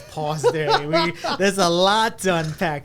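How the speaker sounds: loud laughing interruption. Real, not acted. Cohost cutting in on the story, loud laughing pause. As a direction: laugh through it while stopping the story.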